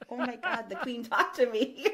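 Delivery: high, loud, giddy mock amazement; starstruck excitement. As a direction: blurt it out, thrilled and laughing. Retelling own amazed reaction, laughing.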